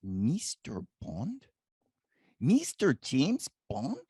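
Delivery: put-on slow, questioning Eastern European accent; rising Eastern European accent, amazed. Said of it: "in his Eastern European accent".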